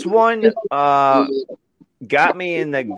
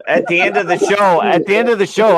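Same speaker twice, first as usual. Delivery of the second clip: higher and louder; excited; 8 semitones higher, 6 dB louder than usual. Real, not acted. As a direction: build it up. Excited retelling repeated.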